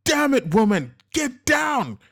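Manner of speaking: high, loud exasperated shout; furious yell. A narrator's reading. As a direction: yell at the frozen waitress. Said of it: "Casper finally yelled".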